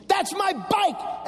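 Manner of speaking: slow, lifted That's my bike; mom's firm claim; slow, high demand. Retold or imitated. Mom telling the laughing thieves, slow and high. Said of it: "she said".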